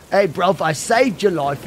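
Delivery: supposed Spanish that comes out Cockney; badly faked Spanish accent, played for laughs; joke Spanish accent. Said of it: "you wanna hear Spanish?".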